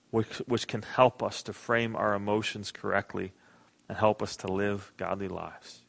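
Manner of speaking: soft, hushed prayer voice; gentle pastor's prayer. Acted. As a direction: pray quietly to the Father. Opening prayer in the sermon.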